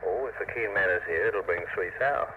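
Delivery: normal talking voice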